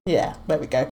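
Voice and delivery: ordinary talk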